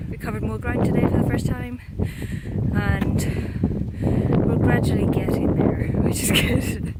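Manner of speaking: talking over loud wind; speaking loudly outside in wind; windy outdoors. Real, not acted. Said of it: "Hopefully you can hear me over the wind".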